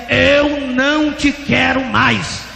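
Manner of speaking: high, loud, very slow proclamation; voice of God. Retold or imitated. God refusing the weeping pastor, slow loud proclamation. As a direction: stretch each word into a ringing refusal. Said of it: "E a voz dizia".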